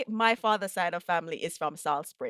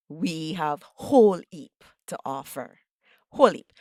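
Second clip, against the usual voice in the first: Jamaican patois; 2 semitones lower than usual. Acted. Host echoing guest in light Jamaican patois. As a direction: lean into Jamaican patois on whole heap.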